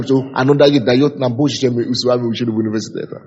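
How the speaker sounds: ordinary delivery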